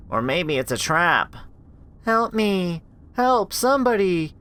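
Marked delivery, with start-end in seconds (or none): 2.0-4.3 put-on high, thin child's plea; pleading little girl. Lost little girl calling through the hallway, pleading.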